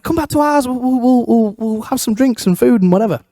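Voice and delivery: high-pitched imitation of friends inviting; mock invitation. Friends inviting him back for drinks, mock high invitation. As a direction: put on a high, sing-song invitation.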